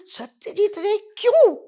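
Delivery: high, loud, slow disbelief; astonished father. Retold or imitated. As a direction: stretch it out in shock. (हैरान पिता, ऊँची, ज़ोरदार, खिंची हुई आवाज़)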